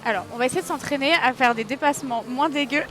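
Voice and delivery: normal talking voice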